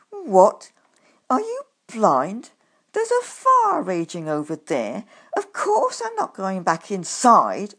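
loud angry snap; snapping. A narrator's reading. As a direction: snap at him, furious. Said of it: "I snapped".

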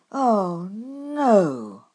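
loud horrified cry of "no!"; a loud wail as he sees the room. Acted. Narrator sees room tidied.

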